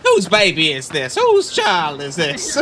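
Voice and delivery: slow, drawn-out, indignant comic voice; Katt Williams voice. Retold or imitated. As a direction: demand an answer from the crowd. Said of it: "He gets up and goes".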